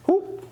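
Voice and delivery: sharp high whoop on the shift; whoop sound effect. Shown as a demonstration. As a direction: vocalize the slip. Coach vocalizing the shift.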